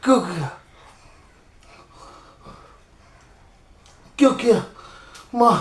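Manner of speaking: barely holding on as life slips; weak dying voice. Acted. Dying son pleading faintly with mother.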